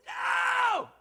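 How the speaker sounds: high, loud cosmic shout; universe shouting no. Retold or imitated. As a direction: bellow it like the universe itself. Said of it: "And the universe is going".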